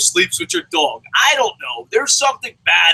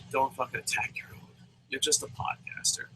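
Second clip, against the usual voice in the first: quiet disappointment; 4 semitones lower, 14 dB quieter than usual. Acted. Disappointed fan addressing Jericho.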